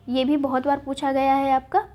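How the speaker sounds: ordinary delivery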